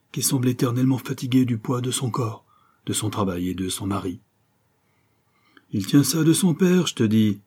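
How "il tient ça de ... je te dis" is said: scornful husband. A narrator's reading. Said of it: "poursuivit monsieur Higginbotham".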